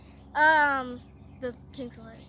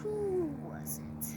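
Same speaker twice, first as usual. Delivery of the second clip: half-whispered, slow question; hushed wondering; 2 semitones higher, 7 dB quieter, much slower than usual. Acted. King puzzling over who saved him, hushed. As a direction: wonder it under your breath, drawn out.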